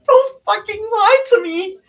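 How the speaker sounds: high, loud sobbing outburst; crying rage. A narrator's reading. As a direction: sob it at him in fury. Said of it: "she sobbed".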